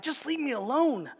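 high, brushing-off snap; mock irritated parent brushing kids off; annoyed parent. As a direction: wave off the kids from the phone. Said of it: "they're like".

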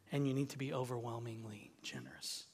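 low, near-whispered aside; quick, hushed and low; hushed emphasis. Real, not acted. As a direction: drop to a soft, low hush. Hushed contrast after emphatic enjoy line.

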